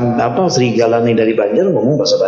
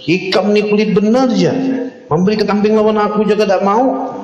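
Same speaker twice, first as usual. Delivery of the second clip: high, reproachful wolf voice; talking wolf; 8 semitones higher than usual. Acted. Wolf scolds the shepherd in Banjar, high. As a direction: scold the stingy shepherd in a raised voice. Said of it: "Kalau bahasa Banjarnya tuh".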